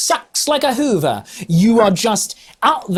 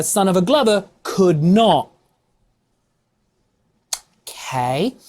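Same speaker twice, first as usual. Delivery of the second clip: usual speaking voice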